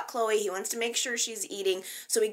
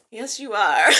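giggling, louder and giddy; laughing; 7 dB louder than usual. Real, not acted. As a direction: laugh through it like a fan swooning. Swooning over Ronan's line.

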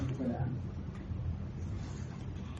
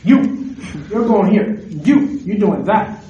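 commanding Paul; 4 semitones higher, 8 dB louder, much slower than usual. Retold or imitated. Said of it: "Paul can easily sit down and go like".

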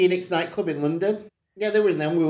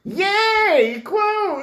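high squealing yay; excited cheer; 4 dB louder than usual. Real, not acted. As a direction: cheer it like a delighted fan. Cheering a fun segment.

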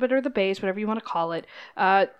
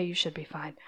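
much softer whisper; whispered reassurance; 6 semitones lower, 12 dB quieter than usual. Acted. Hostess whispering reassurance to the workers. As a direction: drop to a whisper.

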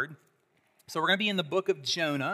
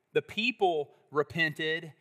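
ordinary talk

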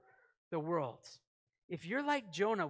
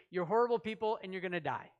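fast, dismissive doom; hurried harsh sentence; quick blunt verdict; faster than usual. Acted. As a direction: rattle off the condemnation fast. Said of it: "he translated it into".

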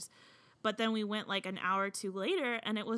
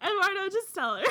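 laughing; 10 semitones higher, 10 dB louder than usual. Real, not acted. Laughing plea.

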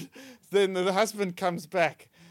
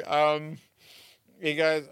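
ordinary talk, nothing marked

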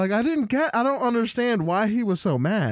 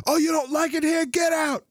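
higher, shouting mock rant; 10 semitones higher than usual. Streamer telling unhappy viewers to leave, hammed-up shout. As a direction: bark it like a hammed-up call to action. Quoting the angry streamer video.

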